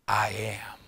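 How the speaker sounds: low, very soft divine voice; hushed, deep revelation; solemn declaration. Acted. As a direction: drop right down and let it land gravely. God reveals himself, solemnly.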